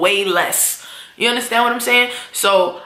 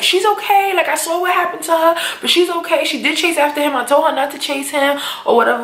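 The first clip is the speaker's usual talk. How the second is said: yelling reassurance; 8 semitones higher than usual. Retold or imitated. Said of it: "lady's yelling in the house".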